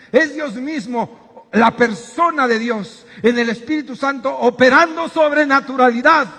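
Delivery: building fervor. Real, not acted. Preacher building to a climax.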